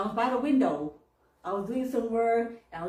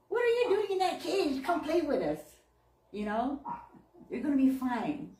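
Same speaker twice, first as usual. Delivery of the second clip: playful bird voice; 6 semitones higher, 3 dB louder than usual. Pretend. Voicing wild birds inviting.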